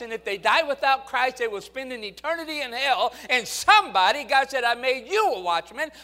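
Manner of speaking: loud, high, fervent revival preaching; full-force preaching. Acted. Pastor exhorting the congregation to go soul winning, full-force preaching. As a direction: shout it out to the congregation.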